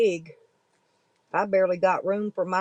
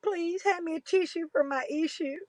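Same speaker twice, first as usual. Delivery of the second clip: high but softer whimpering whine; mock crying plea; 8 semitones higher, 5 dB quieter than usual. Vlogger repeating the tissue plea, softer mock whimper. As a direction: let the fake sob shrink to a pitiful whimper. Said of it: "I'm cry bullying".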